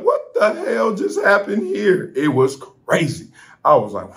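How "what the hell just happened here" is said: high, slow, stunned; stunned disbelief. Retold or imitated. Rider dropped without warning, high and slow in shock. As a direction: let it hang in shock. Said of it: "You're like".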